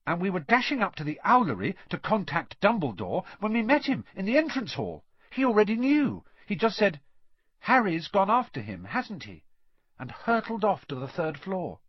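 put-on high-pitched, eager girl recounting; excited schoolgirl telling her story; Hermione. A narrator's reading. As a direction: rattle off the adventure urgently.

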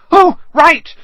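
high, loud, flustered exclamation; sudden realisation. Acted. Hermione remembers she is a witch, startled realisation. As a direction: burst out as it dawns on you.

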